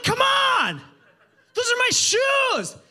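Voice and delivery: very high, loud hammed-up wail; mock-outraged shout about his shoes; anguished outcry. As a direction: cry out over the lost shoes. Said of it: "I'm like".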